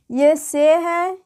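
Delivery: slow, clear "ये से है" with से drawn out; letter name demo. Shown as a demonstration. Teacher showing students a letter name, slowly. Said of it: "बोलते हैं".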